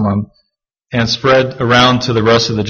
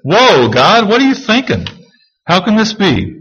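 high-pitched, disbelieving protest, acted out; incredulous Habakkuk; 8 semitones higher than usual. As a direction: question God in mock disbelief, pitch jumping up. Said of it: "Habakkuk just kinda said".

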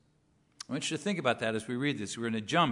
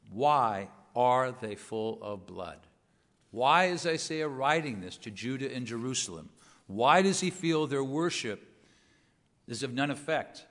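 normal talking voice

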